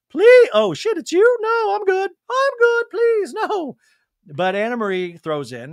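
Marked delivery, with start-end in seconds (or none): 0.1-3.8 very high mock backtracking; hammed-up high voice, pleading to be spared; Dorit. Dorit turns down Anna Marie's help, very high mock alarm. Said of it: "for Dorit to say".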